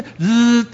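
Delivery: sharp mouth-made "zzz" buzzer; imitated doorbell buzz. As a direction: interrupt with a doorbell noise. Doorbell interrupts the acted argument.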